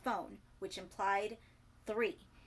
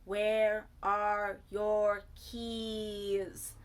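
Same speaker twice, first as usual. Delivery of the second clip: very slow and louder, as to an idiot; speaking slowly; 7 dB louder, much slower than usual. A narrator's reading. Bertie to dazed Ricky, very slow and louder. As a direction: stretch every word, very slowly. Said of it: "She spoke slowly, as if to an idiot".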